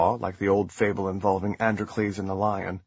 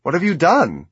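accusing; 7 semitones higher, 6 dB louder than usual. A narrator's reading. Accusing the husband in panic.

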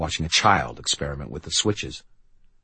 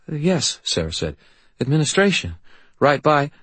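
put-on higher, hesitant teen girl; awkward uncertain girl; young girl voice; 9 semitones higher than usual. Sarah's voice.

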